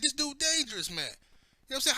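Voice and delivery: ordinary talk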